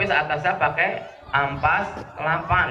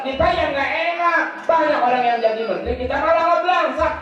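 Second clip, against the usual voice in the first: preaching; 4 semitones higher, faster than usual. Acted. Preacher lecturing the congregation about leaders, forceful preaching. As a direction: build the moral with force.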